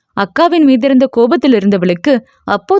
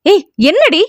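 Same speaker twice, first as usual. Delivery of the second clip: startled cry, higher; startled shout; 6 semitones higher than usual. A narrator's reading. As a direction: jump and cry out in alarm. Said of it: "Meena அதிர்ந்து கத்த". (அதிர்ந்த கத்தல்)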